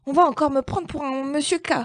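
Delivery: a small cat fussing over its lost cedilla; high, hurried plaintive voice; complaining little cat. Acted. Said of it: "se plaignait Petit Chat".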